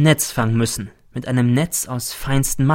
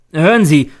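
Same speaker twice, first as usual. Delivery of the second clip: sharp, louder and higher; shouting at him; 4 semitones higher, 7 dB louder than usual. A narrator's reading. Narrator confronts his secretive companion, shouting at him. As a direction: burst out to demand his attention. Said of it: "schrei ich ihn an".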